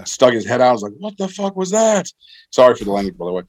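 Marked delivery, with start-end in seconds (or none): 1.0-2.1 imitated high-voiced disbelief; startled reaction. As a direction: act out a bewildered double take, pitched way up. Acted startled reaction to explosion.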